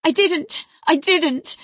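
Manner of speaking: high, loud sobbing denial. A narrator's reading. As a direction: sob it out heartbroken. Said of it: "sobbed Cathy, fit to break her heart".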